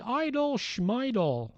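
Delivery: high, slow Barney Fife voice. Retold or imitated. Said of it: "he does a perfect Barney Fife imitation".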